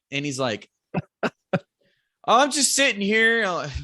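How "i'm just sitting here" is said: high, loud mock holler back; imitated far-off yell. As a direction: imitate a friend yelling from far uphill. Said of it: "We're, like, yelling".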